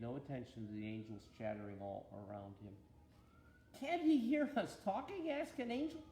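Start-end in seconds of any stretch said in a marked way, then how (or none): none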